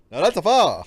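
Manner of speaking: high, loud Eddie Murphy voice; loud comic Eddie Murphy imitation; Eddie Murphy impression. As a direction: belt it out like Eddie Murphy admiring a fire. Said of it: "I'm about hearing Eddie Murphy".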